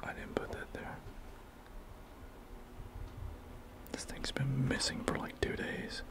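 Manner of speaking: frightened unvoiced whisper; scared whisper. Real, not acted. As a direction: whisper it, afraid something's there. Frightened after object appears.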